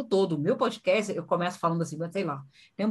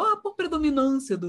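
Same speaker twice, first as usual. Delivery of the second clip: mock pompous academic phrasing, pitched up; stuffy jargon talk; 8 semitones higher than usual. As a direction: parody an overly formal speaker. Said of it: "Eu não falo". (imitando fala rebuscada)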